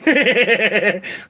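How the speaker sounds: high, rapid giggling; giggle. Real, not acted. Owner amused by his cat, high giggle.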